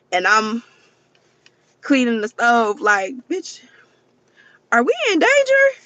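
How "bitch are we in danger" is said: much higher, louder panicked squeal; alarmed. Retold or imitated. Retelling asking her friend on the phone mid-cleaning, panicked high yelp. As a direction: shriek it like you just realized it's dangerous.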